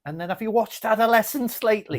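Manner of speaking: high, loud put-on Scouse; Scouse accent. Shown as a demonstration. Asking a friend about a TV show, in a Scouse accent. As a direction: ask it in a broad Liverpool accent. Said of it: "I'm doing this accent".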